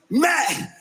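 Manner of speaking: ordinary talk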